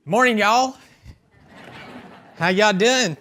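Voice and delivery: loud, high Southern drawl greeting; hammed-up Southern twang hollered to the crowd; mock country accent. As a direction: play up a Nashville country accent for a laugh. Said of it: "that is where my, uh, country accent stops".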